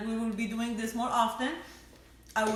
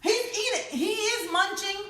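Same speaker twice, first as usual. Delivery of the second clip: high, amused laughing line; 10 semitones higher than usual. Real, not acted. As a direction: giggle it out, teasing. Teasing husband eating from grill.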